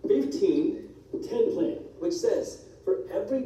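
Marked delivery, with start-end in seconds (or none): none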